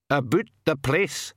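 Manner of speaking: slow, even Scots-like pixie dialect. A narrator's reading. Old pixie clarifies for the puzzled king, even dialect voice. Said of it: "said the pixie evenly".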